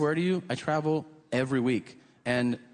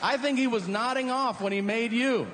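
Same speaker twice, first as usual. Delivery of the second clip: mock polite; 7 semitones higher than usual. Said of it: "interrupt and be like".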